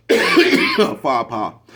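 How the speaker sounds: slow, loud, stretched; drawn-out punchline. Pretend. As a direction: drag each word out loud for effect. Drawn-out punchline after fake cough.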